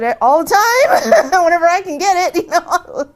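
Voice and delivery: high, loud laughing delivery. Real, not acted. She recounts her joking answer about meat, laughing. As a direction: laugh right through the words, drawing out the start.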